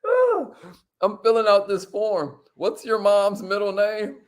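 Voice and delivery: high, put-on innocent voice; exaggeratedly innocent, high-pitched question; mock-innocent asking. Replays the form-filling act.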